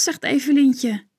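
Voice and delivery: ordinary delivery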